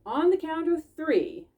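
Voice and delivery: high, loud announcer count; character voice. Acted. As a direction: start the countdown like a referee. Tobin starts the count.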